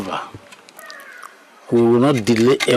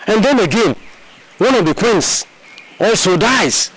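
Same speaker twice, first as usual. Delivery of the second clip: agitated; 6 semitones higher than usual. Acted. Chief tells elders of the deaths, agitated. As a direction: press the strange news.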